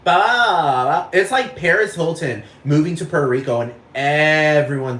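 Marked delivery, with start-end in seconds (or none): 0.0-1.1 loud, high mock ditzy babbling; Valley Girl babble. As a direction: babble nonsense like an airhead. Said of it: "everyone's suddenly like".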